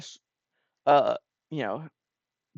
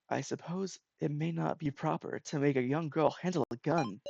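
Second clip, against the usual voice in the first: soft, measured reservation; reserved doubt; 9 dB quieter than usual. Acted. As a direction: voice a polite misgiving very quietly. Reserved character voices a doubt.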